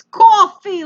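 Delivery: put-on COFFEE in a loud, high Brooklyn accent; mock Brooklyn accent. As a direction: imitate someone putting on Brooklyn. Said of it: "like he's in fucking Brooklyn".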